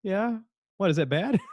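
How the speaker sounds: high-pitched, clueless comeback; mock innocence. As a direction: imitate someone sheepishly asking, rising at the end. Bert innocently asking if it's bad.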